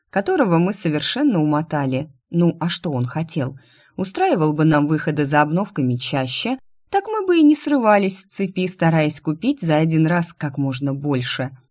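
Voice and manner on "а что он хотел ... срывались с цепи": ordinary delivery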